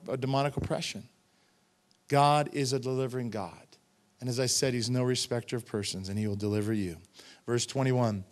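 ordinary talk

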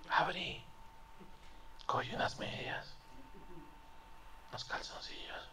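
sneaky whisper, barely voiced; hushed whisper. Sneaking away at night, hushed. (susurro sigiloso)

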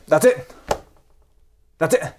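normal talking voice

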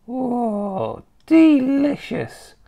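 high, long drawn-out savouring; relishing old grandma. Acted. Grandma remembering gobbled slugs, relishing. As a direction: savour each syllable as a high-voiced old woman.

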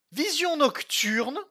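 high, slow gleeful reading; delighted find. Real, not acted. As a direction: savor the loot name with glee. Lit un objet trouvé, ravi. (vision nocturne, aigu, lent et ravi)

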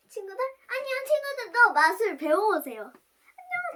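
high, loud sing-song goodbye; bright squeaky sing-song welcome. Real, not acted. Child says goodbye to viewers.

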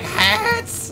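mock high squeak. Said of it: "He's like".

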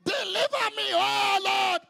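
fervent shouted prayer with long held Oh Lords; a pastor leading the congregation in loud prayer; preaching prayer. Acted. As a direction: cry out each plea to God.

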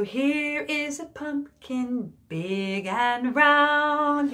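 put-on slow chanted children's rhyme; sing-song rhyme. Shown as a demonstration. Teacher chanting a Halloween rhyme for children. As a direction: chant it gently for little kids.

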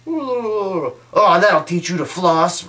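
gloating dentist. Retold or imitated. The dentist taunting her patient, smug and gloating. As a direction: gloat over him like a smug villain.

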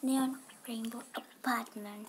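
whispering. Real, not acted. Whispered answer.

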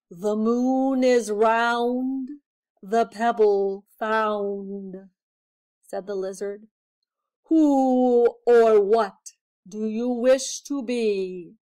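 an ancient creature giving orders; solemn magic lizard. A narrator's reading. Magic lizard telling the mouse what to bring, solemn.

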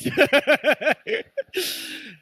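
loud, high burst of laughing; helpless cackle at your own joke; real laughter. Real, not acted. Preacher cracking up at his own parrot joke, laughing.

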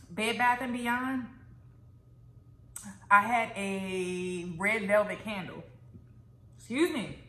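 pausing to swallow between words; talking while chewing. Real, not acted. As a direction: mouth partly full of food. Seen 0: Just ate fries; lips pressed, covers mouth, says excuse me mid-chew.

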